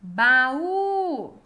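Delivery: "Baú" called loudly, Ú held long; calling out the word. Shown as a demonstration. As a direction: call out "Baú", stretching the final Ú. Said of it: "esse exercício de chamar as palavras".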